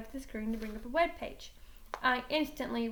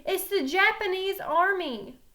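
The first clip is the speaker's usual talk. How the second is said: called out; 7 semitones higher, 7 dB louder than usual. Acted. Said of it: "called Chuck over the headset".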